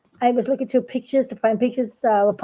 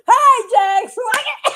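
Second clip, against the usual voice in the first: laughing greeting; 8 semitones higher, slower than usual. Retold or imitated. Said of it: "everybody said".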